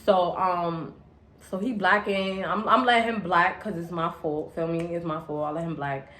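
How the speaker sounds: usual speaking voice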